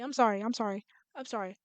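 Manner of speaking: very soft, trailing apologies; sheepish apology. Real, not acted. Player apologising for getting mad, hushed and sheepish. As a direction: shrink the apology down to almost nothing.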